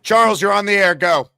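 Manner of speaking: loud, punchy handoff; loud on-air cue. Real, not acted. Host bringing on the next caller, loud handoff.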